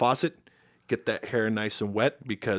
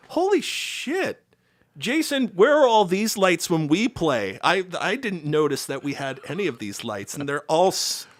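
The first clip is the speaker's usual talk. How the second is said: amazed; 9 semitones higher, 5 dB louder than usual. Retold or imitated. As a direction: gape at the lights in disbelief. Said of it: "I was just like".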